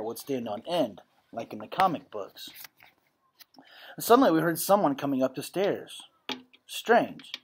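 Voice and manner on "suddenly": plain reading voice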